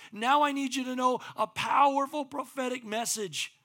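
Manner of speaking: loud, high, driving sermon voice; preaching at full force. Acted. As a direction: preach it out to the whole room.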